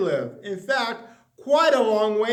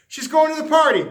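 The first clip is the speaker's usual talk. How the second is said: loud, higher, bright decision; eager yes; 8 semitones higher, 8 dB louder, faster than usual. Acted. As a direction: flip to yes with energy. Narrator flips between decisions.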